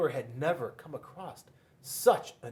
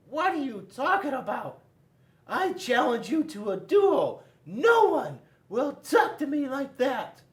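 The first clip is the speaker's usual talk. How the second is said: high, loud, outraged fruit voice; high indignant apricot; 12 semitones higher, 7 dB louder than usual. A narrator's reading. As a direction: squeal out the challenge in offense. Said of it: "Mister Apricot said".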